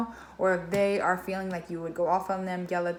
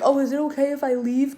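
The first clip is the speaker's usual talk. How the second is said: mock polite asking; 6 semitones higher, 7 dB louder than usual. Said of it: "you weren't like".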